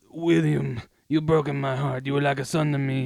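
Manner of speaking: hammed-up Italian-American mobster accent; badly faked mafia-boss accent, mock heartbroken; terrible mobster accent. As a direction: play a wounded don in a bad Italian-American accent. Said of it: "that was a terrible accent".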